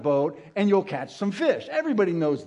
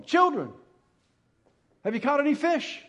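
calling out; 9 semitones higher than usual. Acted. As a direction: call out from the shore. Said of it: "he yells to him, calls to him".

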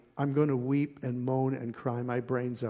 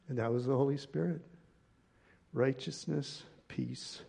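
normal talking voice

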